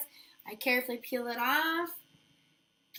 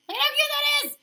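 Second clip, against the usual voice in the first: much higher and louder, bubbling over with delight; gushing excitement; 8 dB louder, much faster than usual. Real, not acted. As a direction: gush over how cute it is. Gushing over finished nail.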